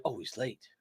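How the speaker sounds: soft, imitated complaint; muttered viewer gripe. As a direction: quote the viewer in a small, quiet voice. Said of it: "one person who's like".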